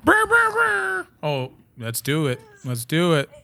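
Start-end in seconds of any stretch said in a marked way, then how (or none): 0.0-1.1 mock drumroll. Excited drumroll noise.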